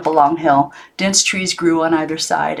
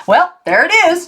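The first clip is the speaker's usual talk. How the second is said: higher, louder announcement; announcing; 12 semitones higher, 4 dB louder than usual. A narrator's reading. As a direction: present it like the big reveal. Uncle Morris announces arrival.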